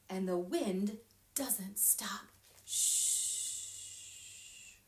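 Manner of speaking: hushed wind whisper; whispered shush. A narrator's reading.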